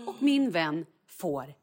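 ordinary delivery

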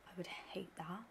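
breathy whispered aside; whispering. Real, not acted. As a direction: drop to a whisper. Echoing friend quietly.

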